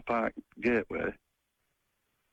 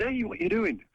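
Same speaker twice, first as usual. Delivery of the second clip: retold shout; 10 semitones higher than usual. Caller retelling how he challenged the attacker, mock shout. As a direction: call out sharply to stop an attacker. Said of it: "I ran down and I shouted".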